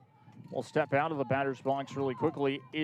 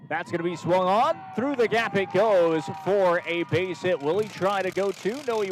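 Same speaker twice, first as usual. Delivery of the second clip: rising excited play-by-play; excited call; 5 semitones higher, 7 dB louder than usual. Real, not acted. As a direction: call the hit with energy.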